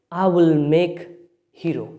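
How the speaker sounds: ordinary talk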